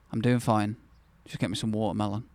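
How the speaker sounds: ordinary talk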